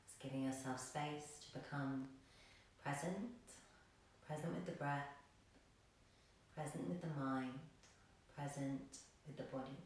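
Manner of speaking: soft, low meditation voice with long pauses; hushed, calm yoga guide; guided meditation. Real, not acted. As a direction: lull them into stillness.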